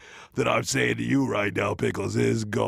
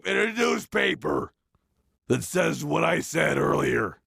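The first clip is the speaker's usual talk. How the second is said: excited shout; 7 semitones higher than usual. Real, not acted. Excited demand for newspaper ad.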